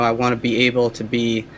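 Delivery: ordinary delivery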